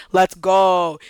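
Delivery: loud, rousing cheer; triumphant call. Retold or imitated. Marcus rallying the riders at the gate, triumphant call. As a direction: call it out like you just won.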